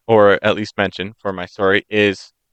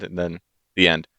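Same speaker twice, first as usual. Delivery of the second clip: ordinary delivery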